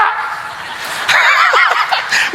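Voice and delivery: a high, airless burst of laughter; real laugh, high and unvoiced; high breathy laugh. Real, not acted. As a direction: laugh at your own joke. Laughs at own joke.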